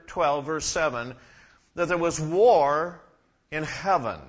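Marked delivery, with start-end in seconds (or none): 1.7-3.0 "war" said louder and drawn out slowly; emphatic preaching. Acted. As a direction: lean hard into "war", slow and loud. Preacher stresses war in heaven.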